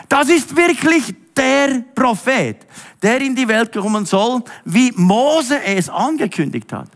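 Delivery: high, drawn-out excited proclamation; enthusiastic acclaim. Retold or imitated. Crowd acclaiming Jesus after his miracle, enthusiastic. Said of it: "riefen sie mit Begeisterung".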